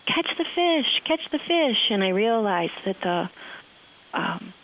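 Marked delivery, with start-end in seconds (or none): 0.1-1.9 high, small voice calling from behind the trees; little voice from the trees. Retold or imitated. Friend hiding behind the trees calls to the crying guide, in a small high voice. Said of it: "this little voice that says".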